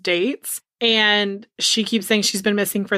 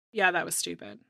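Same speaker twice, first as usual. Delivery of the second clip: ordinary delivery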